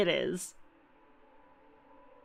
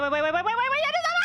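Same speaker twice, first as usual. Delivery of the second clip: rising, quickening run of waits; can't hold it in, it's clicking; breathless building excitement; excited; 10 semitones higher, much faster than usual. Real, not acted.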